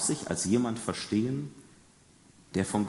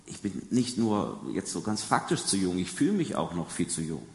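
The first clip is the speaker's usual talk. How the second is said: ordinary delivery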